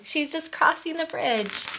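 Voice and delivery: ordinary delivery, nothing marked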